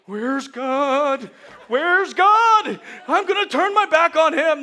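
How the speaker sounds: high, loud, hammed-up cry; mock panicked wail. Stumbling new believer who cannot find God, loud mock panic. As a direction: wail like a lost toddler.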